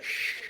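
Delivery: soft, unvoiced shush; quiet "shh" for silence; hushing. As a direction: hush them with a finger to the lips. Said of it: "put a finger to her lips and be like".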